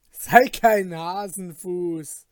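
loud, slow rebuke; scolding. Acted. Companion scolds the frightened goldsmith, loud and slow. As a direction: tell him off, don't be a coward.

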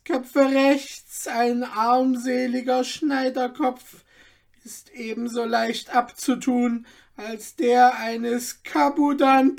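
put-on the old man weighs the danger, then boasts; old tailor pondering. A narrator's reading. Voices the tailor, cautious then bold. (alter Schneider, höher und langsam, vorsichtig)

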